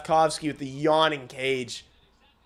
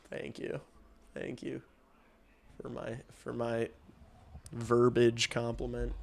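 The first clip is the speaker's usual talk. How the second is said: quiet; 5 semitones lower, 9 dB quieter than usual. Real, not acted. Bashful reply to compliment.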